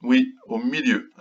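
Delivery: normal talking voice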